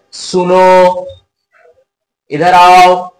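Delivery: "सुनो" and "इधर आओ" called out, each final vowel held long; calling out. Shown as a demonstration. Teacher to pupils, calling out with the final vowels held long. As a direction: call across the room, holding the last vowel three beats. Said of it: "ये सब जो बोल रहे हैं, sir, ये सब तीन मात्रा का समय लग रहा है".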